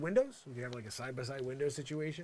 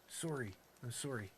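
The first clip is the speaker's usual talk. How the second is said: Canadian sorry; 2 semitones lower, 6 dB quieter than usual. Retold or imitated. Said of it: "all of a sudden I'm Canadian".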